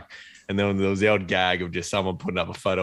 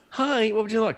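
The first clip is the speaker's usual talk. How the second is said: mock drive-through greeting; 13 semitones higher than usual. Imitating drive-through attendant greeting.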